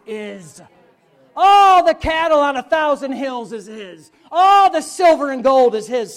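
booming pulpit proclamation with a laugh; preaching at full force. Acted. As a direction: thunder it out like scripture. Preaching climax to the woman.

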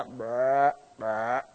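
imitated low buck grunt; short rolled grunt, like a deer call. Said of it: "that's a grunt call".

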